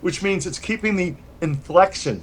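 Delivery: ordinary talk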